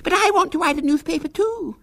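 put-on very high lisping little-girl voice; squeaky wheedling child; Violet Elizabeth Bott. A narrator's reading. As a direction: insist like a shrill six-year-old. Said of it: "with a lisp".

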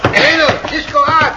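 excited shout. Acted. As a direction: cheer him on as he climbs out. Pancho cheers Cisco climbing out.

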